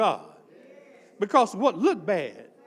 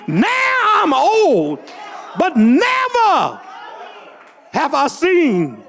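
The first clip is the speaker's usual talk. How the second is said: slow, crying shout, much higher and louder; weeping testimony shout; tearful preaching shout; 6 semitones higher, 8 dB louder, much slower than usual. Real, not acted. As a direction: cry it out from the chest, drawn long. Testifying, quoting scripture in tears.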